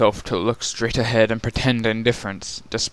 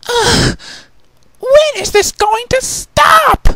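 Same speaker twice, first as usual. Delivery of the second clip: very high, loud shouted complaint; exasperated groan; 5 dB louder, slower than usual. Acted. Rarity being dragged by her horn, loud and exasperated. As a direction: wail in frustration. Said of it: "Rarity groaned".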